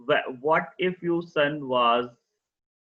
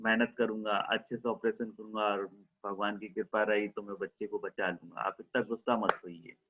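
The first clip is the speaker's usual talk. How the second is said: softer, lower steady reassurance; calm him down quietly; 4 semitones lower, 5 dB quieter than usual. Acted. Surgeon promising the angry father his best, soft. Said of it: "surgeon smile किया".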